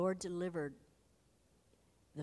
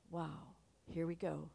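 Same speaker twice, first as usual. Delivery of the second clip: ordinary talk, nothing marked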